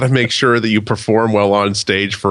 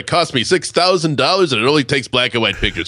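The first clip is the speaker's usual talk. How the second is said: smarmy date guy; 6 semitones higher than usual. Retold or imitated. Mocking a slick dating guy.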